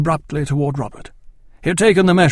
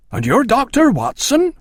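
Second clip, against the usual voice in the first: much higher, louder, starstruck question; voice rising in shock; 10 semitones higher, 6 dB louder than usual. A narrator's reading. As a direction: gasp it out, amazed to meet a celebrity. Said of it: "Robert's voice rose with the shock".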